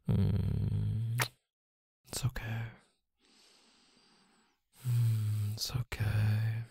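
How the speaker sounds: slow, soft unvoiced whisper. Boyfriend soothing his upset girlfriend, slow whisper. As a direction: hush her gently, slow and breathy.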